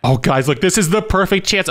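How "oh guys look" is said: normal talking voice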